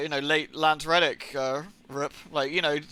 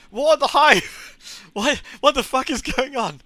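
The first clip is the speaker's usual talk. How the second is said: bewildered laughing; 8 semitones higher, 5 dB louder, slower than usual. Retold or imitated. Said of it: "I'm like".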